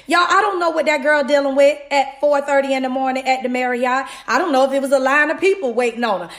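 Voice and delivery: ordinary talk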